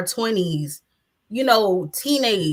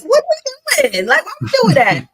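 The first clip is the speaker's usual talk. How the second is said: higher mock-exasperated quote; exasperated girl; 14 semitones higher, faster than usual. Retelling what she said to the other women, higher and exasperated. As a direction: play the fed-up friend asking what they're doing. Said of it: "I was like".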